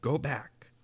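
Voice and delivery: huntsmen's urgent warning; warning cry. A narrator's reading. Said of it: "they cried".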